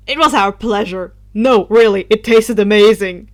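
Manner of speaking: loud, laughing exclamation; exclaiming with a laugh. Acted. Drake gloating about the tasty soul, loud and laughing. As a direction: blurt it out with a delighted laugh. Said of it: "Drake exclaimed with a laugh".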